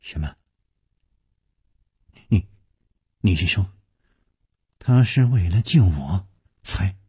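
stunned, low and halting; shaken. A narrator's reading. Youth learning the man he whipped had saved him, shaken. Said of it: "黑衣少年动容说".

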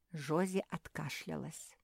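plain reading voice

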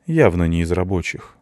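ordinary delivery, nothing marked

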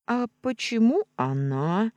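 jealous. Acted. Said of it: "ревниво поинтересовалась та".

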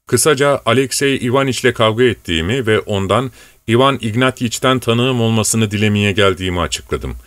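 ordinary delivery

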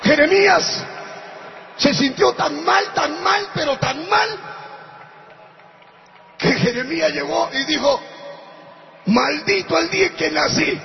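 loud, high, shouted sermon climax; rally the congregation at the top of the voice; full-force preaching. Acted. Pastor shouts praise and exhortation to the congregation, loud and high.

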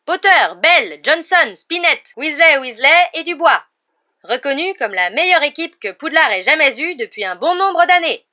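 loud, high-pitched roll call of players; match commentator. A narrator's reading. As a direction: belt out the line-up over the crowd. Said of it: "qui assurait le commentaire du match". (commentateur de match survolté)

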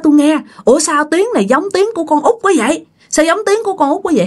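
high, rushed puzzlement; acted startled questioning; startled old father. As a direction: blurt it out fast and high in disbelief. Old man startled by dead daughter's voice.

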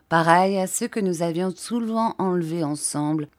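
plain reading voice

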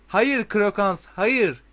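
high, drawn-out narrated shout. A narrator's reading. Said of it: "diye bağırdı cadı".

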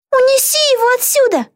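high-pitched command; commanding order. A narrator's reading. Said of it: "приказала Битти". (приказ высоким голосом)

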